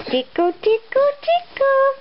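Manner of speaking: high squeaky tickle; baby talk. Acted.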